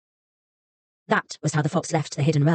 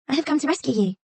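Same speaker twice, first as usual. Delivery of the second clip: put-on high girlish voice; a kind girl reassuring the fox; little girl; 8 semitones higher than usual. A narrator's reading.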